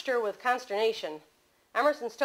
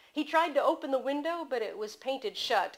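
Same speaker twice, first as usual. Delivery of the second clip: usual speaking voice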